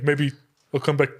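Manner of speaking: ordinary talk, nothing marked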